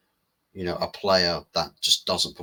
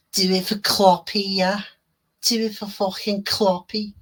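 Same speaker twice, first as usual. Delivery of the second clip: much higher Northern English lad voice, cheering; hammed-up Northern English accent; 13 semitones higher, 4 dB louder than usual. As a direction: squeal it like a fired-up Northern English fan. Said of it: "butchering, um, accents".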